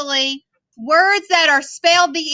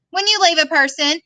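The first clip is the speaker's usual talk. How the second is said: ordinary delivery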